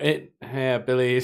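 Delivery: normal talking voice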